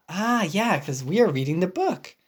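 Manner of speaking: plain reading voice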